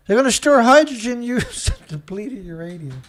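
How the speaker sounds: breaking into laughter mid-line; can barely get the words out; laughing through words. Real, not acted. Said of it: "I can't even read it".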